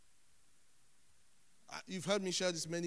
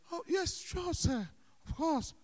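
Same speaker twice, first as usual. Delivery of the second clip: high, louder mock-willing reply; acted eager consent, pitched way up; over-eager agreement; 12 semitones higher, 7 dB louder than usual. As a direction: put on an obliging yes-man voice. Acts himself obligingly answering pastor.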